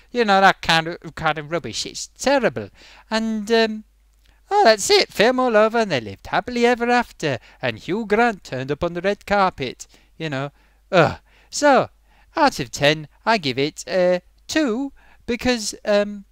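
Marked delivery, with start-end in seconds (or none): none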